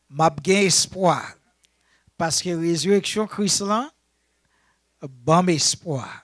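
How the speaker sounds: loud, rousing chant-like phrases; rally the congregation to repeat after you; punchy short phrases with pauses for response; call-and-response preaching. Real, not acted.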